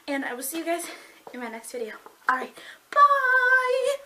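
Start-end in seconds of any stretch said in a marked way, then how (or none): 2.9-3.9 long, high, loud sing-out; big stretched sign-off; called-out goodbye. Real, not acted. Calling goodbye to end the video.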